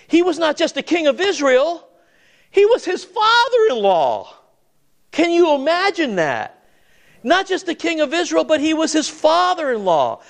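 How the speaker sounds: high-pitched, astonished preaching; incredulous emphasis. Real, not acted. Preacher revealing David's persecutor to the congregation, high and incredulous. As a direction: marvel at it, pitch jumping way up. Marvels at who the persecutor was.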